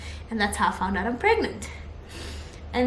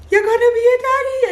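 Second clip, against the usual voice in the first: loud, high, quick burst of news; thrilled shout, words tumbling out fast; excited announcement; 12 semitones higher, 7 dB louder, faster than usual. Retold or imitated. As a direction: blurt it out to your husband. Announcing pregnancy to husband.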